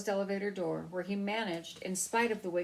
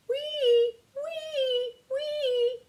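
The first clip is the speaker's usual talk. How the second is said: very high, loud siren squeal; siren noise; 7 dB louder, much slower than usual. A narrator's reading. Mouse in toy ambulance, very high siren wee.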